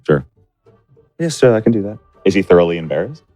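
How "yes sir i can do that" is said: normal talking voice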